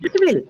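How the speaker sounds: high-pitched insistence, falling at the end; teacher overruling a wrong answer; emphatic correction. Real, not acted. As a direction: correct the class firmly, pitched well up. Teacher insists against students' no.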